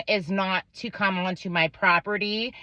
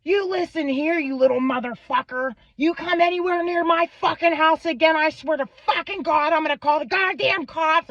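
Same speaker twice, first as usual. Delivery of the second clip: imitating angry dad; 9 semitones higher than usual. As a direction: ham up the enraged father yelling down the phone. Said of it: "started motherfucking my fourteen-year-old, going".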